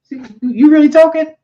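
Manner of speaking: high-pitched grandma disbelief; mock amazed grandma. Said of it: "she was like".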